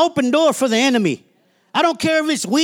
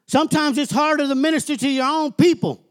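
ordinary talk, nothing marked

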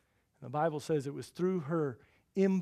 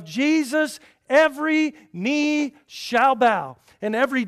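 loud, high, emphatic sermon delivery; full-force preaching; 8 semitones higher, 13 dB louder, slower than usual. Real, not acted. Preacher proclaiming the authority of Jesus, loud and forceful. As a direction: proclaim it to the whole congregation.

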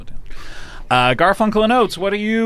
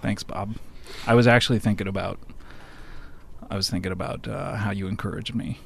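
hushed, choked-up thanks; barely-there voice, fighting tears; quiet; 12 semitones lower, 13 dB quieter, faster than usual. Acted. Moved by father figure praise.

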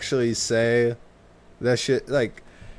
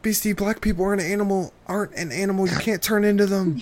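cartoon character voice; 6 semitones higher, faster than usual. Playing Teen Titans character.

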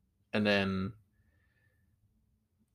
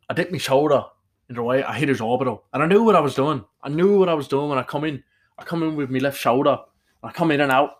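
put-on loud, raised Irish accent, rolling on; Conor McGregor; 5 semitones higher, 7 dB louder than usual. Fighter explaining his shoulder move, Irish accent. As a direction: recount the fight in boastful Irish patter. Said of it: "all credit to Conor McGregor".

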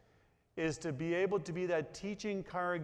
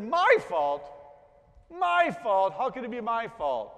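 higher, louder incredulous protest; indignant disbelief; 4 semitones higher, 5 dB louder than usual. Retold or imitated. Charlie Brown protests.

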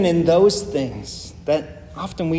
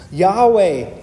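Yahweh said higher and louder, falling; emphatic Yahweh; 4 semitones higher, 5 dB louder than usual. Shown as a demonstration. Preacher stressing the covenant name, emphatic Yahweh. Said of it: "start with the emphatic".

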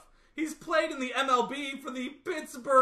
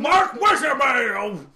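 shouted name; 4 semitones higher, 7 dB louder, slower than usual. Acted. Persona shouting own name.

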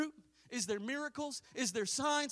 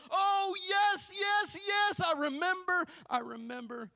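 joyful remembering; 9 semitones higher, 8 dB louder than usual. Retold or imitated. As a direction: shout the memory, then let it settle. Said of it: "it needs the church to go".

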